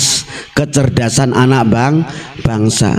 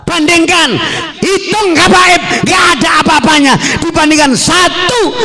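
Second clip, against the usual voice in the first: high, forceful challenge to the crowd; impassioned, raised-voice preaching; rallying shout; 14 semitones higher than usual. Real, not acted.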